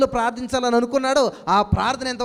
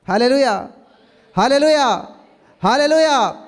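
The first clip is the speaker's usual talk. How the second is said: loud, higher, slow and ringing, shouting hallelujah; full-force preaching; 3 semitones higher, 6 dB louder than usual. Acted. Pastor rousing his congregation with louder, higher preaching. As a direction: preach it out to the church with rising fervour.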